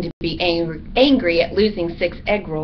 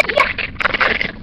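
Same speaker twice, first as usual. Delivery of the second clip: very high squeaky noise of disgust; cartoonish bat yuck. A narrator's reading. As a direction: squeal it out in revulsion.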